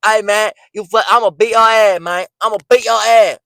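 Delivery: mock the slurred street-accent bluster. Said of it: "you can't even say".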